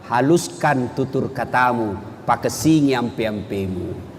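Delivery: ordinary talk, nothing marked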